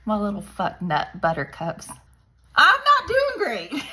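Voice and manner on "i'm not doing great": laughing high and slow. Real, not acted. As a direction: laugh through it.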